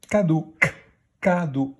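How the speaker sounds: cadeau with its first k pulled out alone, then ca-deau stretched; isolating the k. Shown as a demonstration. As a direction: stress the k sound in cadeau. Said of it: "où on entend le son K".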